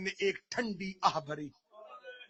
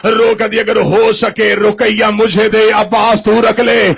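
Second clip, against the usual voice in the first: pleading wail; 13 dB louder than usual. Acted. Zainab begging Husain for his children, wailing. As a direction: plead and let it break into a cry.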